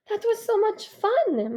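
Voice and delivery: high, drawn-out delight. Acted. Mother to father after dancing, delighted. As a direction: pitch it way up and stretch it.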